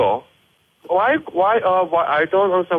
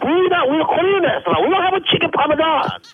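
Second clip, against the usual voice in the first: higher, exasperated insisting; exasperated correction; 8 semitones higher than usual. Acted. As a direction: snap back that it's Korean. Heated quarrel with restaurant.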